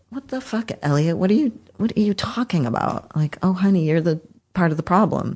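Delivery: high, sassy mock rebuke; incredulous self-scolding. Imitating scolding himself over closing off, sassy and high. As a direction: scold yourself with exasperated sass. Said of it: "And it's just like".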